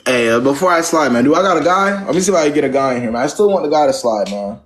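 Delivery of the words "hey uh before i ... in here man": ordinary delivery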